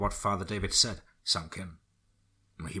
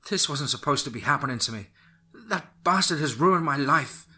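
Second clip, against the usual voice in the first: anguished angry cry; 6 semitones higher, 6 dB louder than usual. Acted. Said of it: "Lizzie screamed out in rage".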